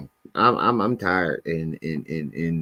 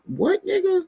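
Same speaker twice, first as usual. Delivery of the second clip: high-pitched squeal of disbelief; incredulous; 3 dB quieter than usual. Real, not acted. Streamer reacting to a sound-alike rapper, incredulous squeal.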